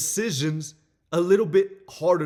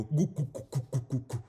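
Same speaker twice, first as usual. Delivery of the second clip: put-on light cooing baby noises; goofy baby talk; 3 dB quieter than usual. Partner cheering up a sad partner with goofy baby cooing. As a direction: coo like you're cheering someone up. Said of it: "they rub your head, and go".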